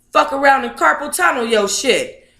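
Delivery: normal talking voice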